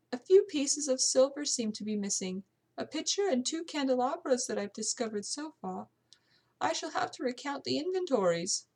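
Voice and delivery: amateur British servant voice; British housekeeper. Acted. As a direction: voice a servant speaking up about her household.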